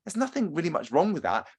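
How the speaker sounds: normal talking voice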